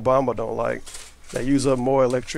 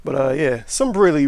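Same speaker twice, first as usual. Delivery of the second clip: ordinary talk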